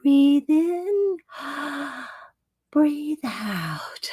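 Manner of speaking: high, airy mock yoga instructor; yoga doll guiding breath, whispered at the end; yoga girl voice. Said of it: "she actually talks".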